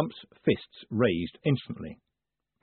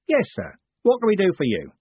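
much higher, louder hearty coachman; coachman's voice; 10 semitones higher, 5 dB louder than usual. A narrator's reading. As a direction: greet him like a bluff coachman. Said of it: "and then said".

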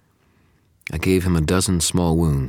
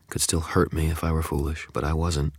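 ordinary delivery

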